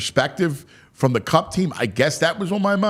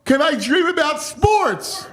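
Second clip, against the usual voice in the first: exasperated outcry; 12 semitones higher than usual. Real, not acted. Host appalled that he dreams about sports, exasperated. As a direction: cry out high in disbelief, stretching the words.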